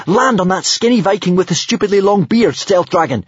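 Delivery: high, loud urgent command; shrieking. A narrator's reading. Hiccup orders the dragon to land, shrieking. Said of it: "shrieked Hiccup".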